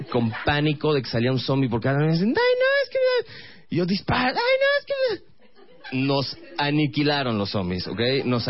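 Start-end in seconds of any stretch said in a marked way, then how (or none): 2.3-3.7 put-on very high, squeaky panicked girlfriend voice; panicky girlfriend. 4.3-5.4 put-on very high, squeaky panicked girlfriend voice; panicky girlfriend.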